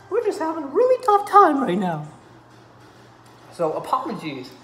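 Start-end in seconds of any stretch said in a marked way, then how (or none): 0.1-2.0 high, loud hammed-up wail; mock wail. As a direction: wail it like a melodramatic soap star. Mock-sad video plea to friend.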